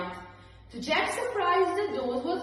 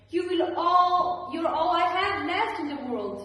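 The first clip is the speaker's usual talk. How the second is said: pleading; 7 semitones higher, 6 dB louder than usual. A narrator's reading. Mother pleading.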